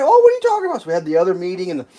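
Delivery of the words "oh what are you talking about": very high put-on voice; mock innocence. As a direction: play dumb in a squeaky high pitch. Contractors feigning ignorance, mocked.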